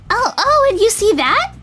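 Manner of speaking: put-on high, eager girl voice; bubbly, high-pitched chatter; excited dandelion girl. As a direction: gush as if pointing out favourite shops.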